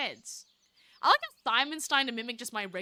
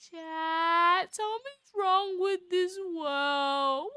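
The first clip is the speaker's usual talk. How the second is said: scared; 5 semitones higher, much slower than usual. Real, not acted. Streamer spooked by game.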